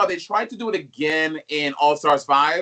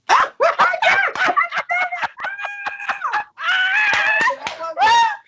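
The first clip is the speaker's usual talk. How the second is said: high squealing burst of laughter; shrieking laugh; 3 dB louder than usual. Real, not acted. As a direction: break into a screaming cackle. Laughs after her own accent attempt.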